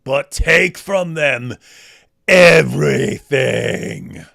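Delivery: slow, loud epic war speech; mock epic hero. Quoting the Spartan king's speech, slow and loud. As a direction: draw each word out like a movie king.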